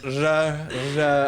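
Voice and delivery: "ra", a loud try at trilling the R; attempted rolled R. Shown as a demonstration. Showing a friend they can't roll their R's, attempted trill on "ra". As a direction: have a failed go at rolling the R in "ra". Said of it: "if I try to roll my R's".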